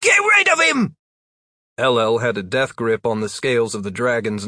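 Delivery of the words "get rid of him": very high, loud shriek. A narrator's reading. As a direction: shriek it in terror. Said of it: "Only Donnie shrieked".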